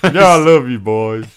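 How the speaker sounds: high, loud, hammed-up father; hammy loving father. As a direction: gush it like a melodramatic dad. Father voiced, laughing.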